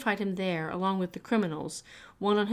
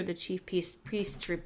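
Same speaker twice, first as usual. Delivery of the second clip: plain reading voice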